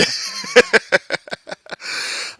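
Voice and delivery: shrieking wheeze of laughter; high squealing laugh. Real, not acted. Huge laugh at the clip.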